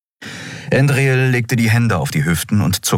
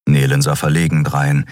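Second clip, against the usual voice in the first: ordinary delivery, nothing marked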